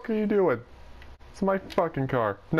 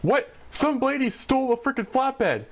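high-pitched, astonished exclamation; incredulous outburst; 9 semitones higher than usual. Real, not acted. Gamer telling a friend the flatbed got stolen, incredulous outburst. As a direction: blurt it out high in disbelief.

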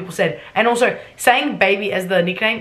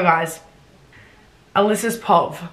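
usual speaking voice

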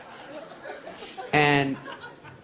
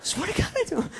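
high, squeaky protest; pleading denial. Acted. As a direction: plead innocence in a comic squeak. Acts out lying under accusation.

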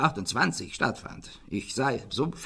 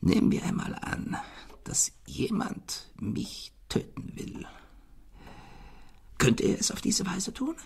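fearful whisper; 8 semitones higher, 3 dB quieter than usual. Acted. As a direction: whisper it as if someone might hear. Fearful secret suspicion voiced. (ängstliches Flüstern)

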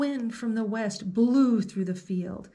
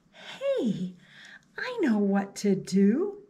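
put-on 1 proclaiming girl; slower than usual. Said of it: "she proclaimed".

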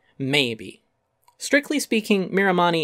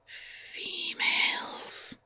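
slow drawn-out whispered word; leering whisper; 10 dB quieter, much slower than usual. Pretend. Essayist mocking Ferengi interest in females, slow leering whisper. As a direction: breathe it out slowly like a leering Ferengi. Mock Ferengi aside on sex list.

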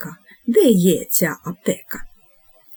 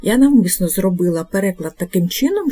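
usual speaking voice

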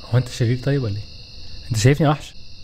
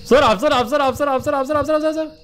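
frantic urging; 8 semitones higher than usual. Real, not acted. Urging himself to hurry.

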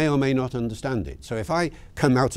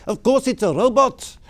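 put-on high, loud South African accent; 11 semitones higher, 5 dB louder than usual. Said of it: "in a lovely South African accent".

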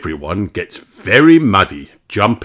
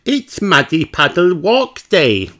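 put-on high, lively little girl voice; Peppa's voice; 10 semitones higher than usual. Peppa telling Granny about the walk, high and eager. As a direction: chirp it like an eager little pig. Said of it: "says Peppa".

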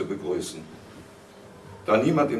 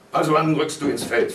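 raised, loud questioning; higher, louder interrogation; stern father's demand; 5 semitones higher, 6 dB louder than usual. A narrator's reading. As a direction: press for an answer impatiently. Father demands an answer from son.

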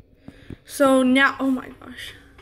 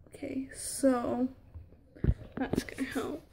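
whispering; 12 dB quieter than usual. Real, not acted. Working quietly on the Furby.

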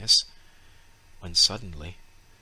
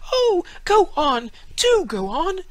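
put-on children pleading; 9 dB louder than usual. A narrator's reading. Children clapping, begging the storyteller to continue. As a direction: beg like delighted children. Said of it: "clapped their hands and cried".

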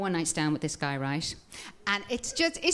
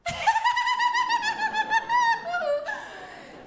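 very high squealing put-on giggle; hammed-up flirty laugh; mock sexy laugh. Comedian to crowd, squealing mock sexy laugh. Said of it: "my sexy little laugh".

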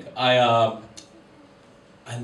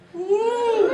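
cheering woo; 4 dB louder than usual. Real, not acted. Cheering for a fellow comic.

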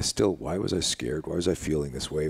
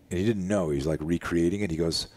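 ordinary delivery

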